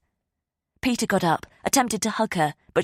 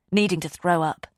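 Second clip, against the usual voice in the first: plain reading voice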